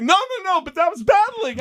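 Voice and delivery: very high, protesting voice; hammed-up desperate excuse to a girlfriend; frantic pleading. Acting out pleading with departing girlfriend.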